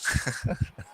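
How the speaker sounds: soft, voiceless chuckling; short chuckle. Real, not acted. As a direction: chuckle softly at your own joke. Laughs at own joke.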